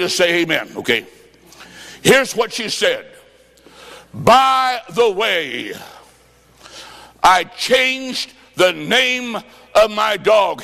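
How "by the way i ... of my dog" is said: very slow, stretched delivery; preacher stretching the joke line slowly; drawn-out punchline. Retold or imitated. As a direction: drag every word out for the laugh.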